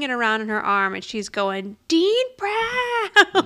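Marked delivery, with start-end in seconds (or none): 1.9-3.4 high laughing yell of his name. Retold or imitated. Drunk Angela yelling the dean's name while dancing, high and laughing. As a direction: whoop his name like a party cheer. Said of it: "and yelling his name".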